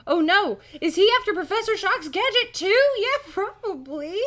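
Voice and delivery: loud, very high panicked realization; mock alarm. Kid suspecting shopkeeper wants the gadget, hammed-up alarm. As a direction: gasp out the worry, hammed-up and high. Acted alarm while reading the story.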